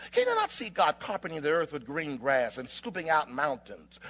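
normal talking voice

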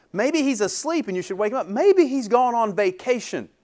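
high-pitched sarcastic taunt; mocking suggestion. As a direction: pretend to offer helpful excuses, pitched high. Elijah taunting the prophets, paraphrased.

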